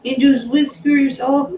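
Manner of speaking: high, sweet cooing. Real, not acted. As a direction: coo over a fluffy kitten. Cooing at a pet on camera.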